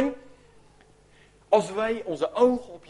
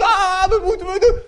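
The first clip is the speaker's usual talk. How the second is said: high, loud panicky wail with a laugh in it; panicked cry; 9 semitones higher, 6 dB louder than usual. Acted. Disciples panicking in the storm, hammed-up high wail. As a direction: hands in the air, mock panic.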